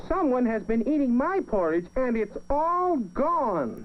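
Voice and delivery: wee little voice. A narrator's reading. Baby Bear finds his porridge all gone, tiny high voice. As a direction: squeak it sadly like a little bear. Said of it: "in his wee little voice".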